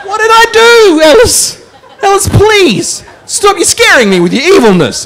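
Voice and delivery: high, pleading mock-scared cry; mock fright. Host pleading with Ellis over his evil brain, mock fright. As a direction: laugh through a pretend-terrified plea.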